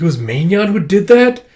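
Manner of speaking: acted shocked disbelief; incredulous question. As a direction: ask in disbelief, voice lifting.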